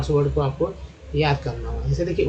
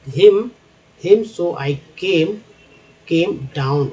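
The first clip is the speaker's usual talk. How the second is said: slow word-by-word reading for learners; teacher modelling slow, deliberate reading; reading demonstration; 5 dB louder, much slower than usual. Shown as a demonstration. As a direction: read each word separately and drawn out. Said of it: "कैसे मैं पढ़ता हूँ".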